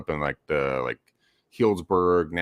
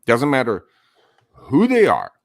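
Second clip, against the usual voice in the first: normal talking voice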